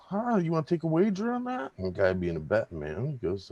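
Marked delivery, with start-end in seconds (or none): 0.1-1.7 much higher, teasing challenge; sly owner. Acted. Owner tempting the bartender into a wager, higher voice. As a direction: dangle the bet playfully. Joke, owner offers a bet.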